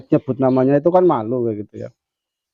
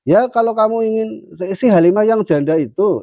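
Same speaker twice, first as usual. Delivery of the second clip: much higher, louder teacher's voice; pitched-up kiai voice; 11 semitones higher, 4 dB louder than usual. Retold or imitated. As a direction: play the kiai with a bright lift. Kiai answering the student.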